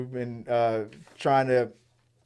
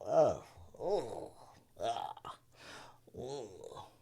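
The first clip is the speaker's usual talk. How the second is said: soft, pained oh sounds trailing off; mock pained moaning; pained groans; 2 semitones lower, 13 dB quieter, much slower than usual. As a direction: groan like a suffering teacher. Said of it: "that's the sound he looks like he's making".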